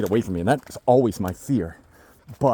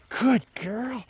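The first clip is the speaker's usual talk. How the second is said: whispered praise; 10 semitones higher, 3 dB quieter than usual. Real, not acted.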